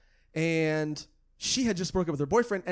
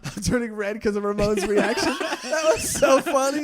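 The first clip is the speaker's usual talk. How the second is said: high, loud words through giggles; flustered and laughing; embarrassed laughter; 5 semitones higher, 6 dB louder than usual. Real, not acted. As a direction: say it while cracking up. Laughing at co-host's reaction.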